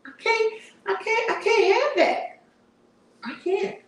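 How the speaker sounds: high, emphatic I can't; refuse, throwing up your hands; high, fed-up "I can't"; exasperated refusal. Real, not acted. Refusing a stubborn pet.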